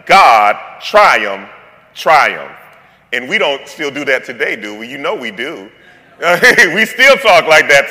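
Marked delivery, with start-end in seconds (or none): 0.0-2.5 each word punched loud and low; God, triumph, triumph stamped out one by one; emphatic repetition. Shown as a demonstration. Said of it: "it would sound like".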